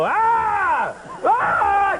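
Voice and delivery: high, loud yelling scream; demo yell. Shown as a demonstration. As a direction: yell like a madman to scare them off. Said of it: "just start yelling".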